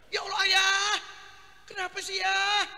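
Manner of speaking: wife fussing over her husband. Acted. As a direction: fret over the sick husband. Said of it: "istri kan sayang sama suami".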